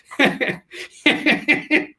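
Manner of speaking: high airy laugh; breathy laugh. Real, not acted. Laughs at own joke.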